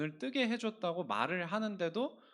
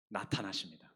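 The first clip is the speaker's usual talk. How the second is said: hushed reveal, whispered and softer; dramatic whisper; 11 semitones lower, 9 dB quieter than usual. Acted. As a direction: whisper it like a secret entrance. Dramatic reveal of Jesus appearing.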